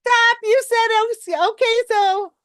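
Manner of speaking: mock exasperated coach. Coach interrupting a client's weak opening, high and mock-exasperated. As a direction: play the fed-up coach stopping a client mid-word. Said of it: "I'm like".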